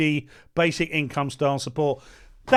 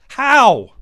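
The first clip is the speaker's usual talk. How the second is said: loud, higher barked question; shouted demand; 5 semitones higher, 9 dB louder than usual. Real, not acted. Pressing the Greens for an answer, loud. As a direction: hit it hard.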